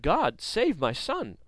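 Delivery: a pleading mother's cry, drawn out; desperate cry. Retold or imitated. Mother in danger crying out to God, slow desperate plea. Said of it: "I cried out in my mother tongue".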